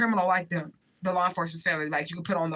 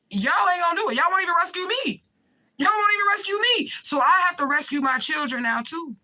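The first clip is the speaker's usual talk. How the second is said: much higher and louder, voice cracking in despair; anguished outcry; 10 semitones higher, 4 dB louder than usual. Real, not acted. Telling the family they won't rescue her, anguished outcry. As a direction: cry out that nobody will rescue her.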